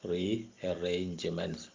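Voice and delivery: "rearrangements" drawn out very slowly, re-ar-range-ments; syllable by syllable. Shown as a demonstration. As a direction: sound out each syllable of "rearrangements" separately. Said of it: "Many syllables we can see".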